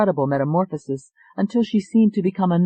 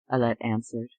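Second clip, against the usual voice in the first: ordinary delivery, nothing marked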